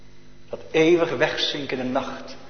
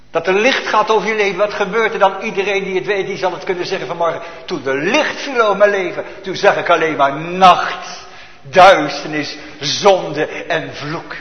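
preaching at full force; 4 semitones higher, 6 dB louder than usual. Acted. Preaching sermon climax.

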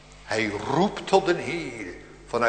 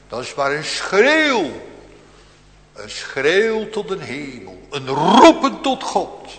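fervent preaching; 3 semitones higher, 8 dB louder than usual. Acted. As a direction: let it ring to heaven. Preaching on the cry to heaven.

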